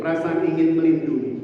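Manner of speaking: ordinary talk, nothing marked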